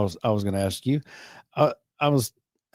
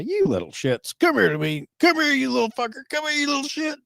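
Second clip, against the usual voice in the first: playful, much higher mock scolding, getting faster; playful cussing dad. A dad play-cursing to call his little kids over, high and teasing. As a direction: tease the kids with fake cussing, pitched up high. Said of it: "I would play talk like that".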